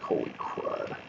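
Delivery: breathless whispered shock; stunned whisper. Retold or imitated. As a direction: whisper it as if seeing a friend dying. Stunned reaction to dying friend.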